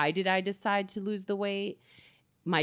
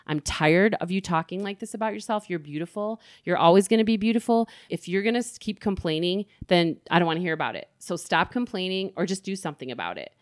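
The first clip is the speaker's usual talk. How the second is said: usual speaking voice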